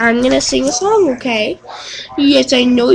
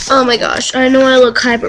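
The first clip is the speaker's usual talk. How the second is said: ordinary talk, nothing marked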